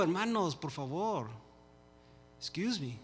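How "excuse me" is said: ordinary delivery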